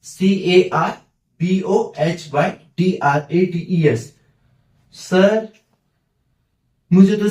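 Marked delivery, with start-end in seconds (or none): 0.0-4.2 slow letter-by-letter spelling; slowly spelled English word. Shown as a demonstration. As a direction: spell it out one letter at a time. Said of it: "सिर्फ यहाँ पे word पढ़ना है".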